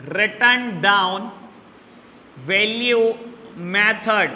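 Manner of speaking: "written down value method" very slow, with long pauses; dictation. Shown as a demonstration. Teacher repeating the second method for students, very slow dictation. Repeats the name for note-taking.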